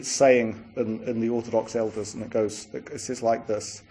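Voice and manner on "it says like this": normal talking voice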